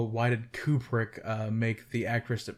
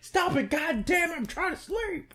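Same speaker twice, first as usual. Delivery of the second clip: much higher, whiny stop it; mock annoyed camper. Camper yelling at the rock-thrower, high whiny mock complaint. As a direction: squeal it like a grumpy sleeper.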